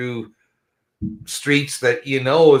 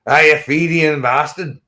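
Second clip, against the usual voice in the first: slow, louder mock Scottish jibe; mock Scottish insult; 3 semitones higher, 5 dB louder, slower than usual. Host quoting a mock sectarian insult at his Scottish friend, slowly. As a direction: drag out the insult in a playful Scottish voice. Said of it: "I don't know if he's a Fenian".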